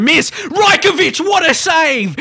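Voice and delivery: screaming the keeper's name, very high and loud; elated shout. Real, not acted. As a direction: erupt with joy at the save. Keeper saves the penalty.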